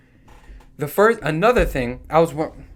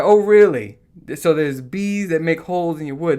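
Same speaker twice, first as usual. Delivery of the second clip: a loud, high sarcastic "oh really"; sarcastic wonder at bees making holes; mock amazement; 3 semitones higher, 6 dB louder than usual. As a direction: overplay the surprise.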